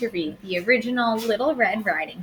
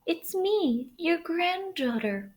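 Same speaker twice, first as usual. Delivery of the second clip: higher, slow and softer put-on girl voice; wolf faking a young girl; 9 semitones higher, 4 dB quieter, slower than usual. Wolf fools grandmother by faking a girl's voice. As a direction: disguise the wolf as a sweet child. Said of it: "doing his very best to sound like a young girl".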